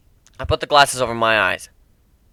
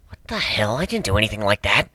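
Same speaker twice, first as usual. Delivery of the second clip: usual speaking voice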